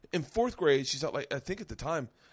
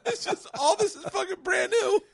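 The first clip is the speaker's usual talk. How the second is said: very high, loud, cracking up; real laughing hard; 6 dB louder than usual. Real, not acted. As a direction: squeal the words through laughter. Riffing on joke while cracking up.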